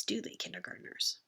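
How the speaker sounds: usual speaking voice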